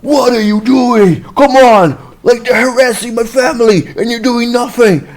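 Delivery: high, loud mock berating; bossy scolding. Man berating police like his minion, indignant yelling. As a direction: order the officer about in outrage. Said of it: "as if he was, like, his minion".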